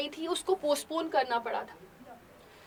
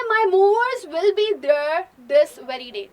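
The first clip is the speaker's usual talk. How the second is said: louder, slow, stubborn insistence; insistent claim; 7 dB louder, much slower than usual. Acted. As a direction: drive it home. Natalia insists in the quarrel.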